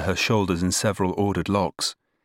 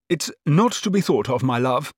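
brisk dismissal; 6 semitones higher than usual. A narrator's reading. Cousin dismisses the poor suitor.

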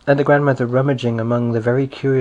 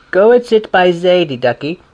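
higher, louder soothing voice; comforting big neighbour; 6 semitones higher, 6 dB louder than usual. A narrator's reading. Neighbour comforts the crying little girl, loud and soothing. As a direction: coax the weeping child along.

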